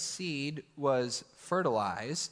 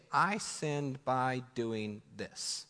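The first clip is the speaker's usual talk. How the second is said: slow deliberate confession; 2 semitones lower, much slower than usual. Shown as a demonstration. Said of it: "You need to say".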